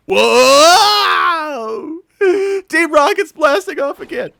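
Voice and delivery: high, loud cartoon wail; mock flung-away yell. Cartoon villains blasting off, high mock yell. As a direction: cry out as if launched skyward.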